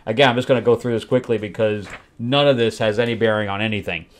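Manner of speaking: ordinary delivery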